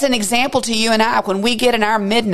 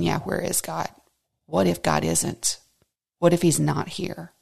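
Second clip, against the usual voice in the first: doubting murmur; 3 semitones lower, 7 dB quieter than usual. Retold or imitated. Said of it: "He wants us to start thinking".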